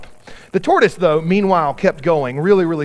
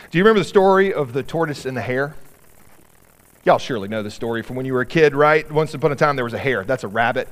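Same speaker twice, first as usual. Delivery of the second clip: usual speaking voice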